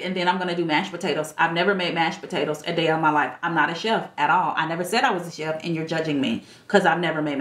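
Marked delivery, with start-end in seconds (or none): none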